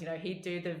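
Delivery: usual speaking voice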